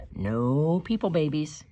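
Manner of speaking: usual speaking voice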